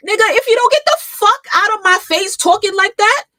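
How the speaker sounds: very high, very loud rebuke; furious shout. Real, not acted. Streamer telling off the man in the clip, furious shout. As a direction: yell at him to back off.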